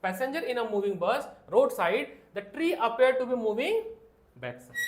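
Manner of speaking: reading the NCERT line aloud word by word; drawn-out dictation to the class; slow textbook reading. Shown as a demonstration. Teacher reading the textbook line slowly to students. Rereads line, stressing words.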